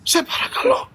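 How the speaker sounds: high, airy, half-whispered plea; hushed pleading. Real, not acted. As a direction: beg under your breath, voice up high. Begs her to stop shouting.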